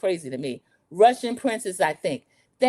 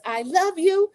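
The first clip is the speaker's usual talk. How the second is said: high mock-emotional voice; put-on dramatic declaration; Martha in an argument; 10 semitones higher, slower than usual. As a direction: imitate a pleading friend, pitched up. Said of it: "trying to do their accents and these loud, horrible arguments".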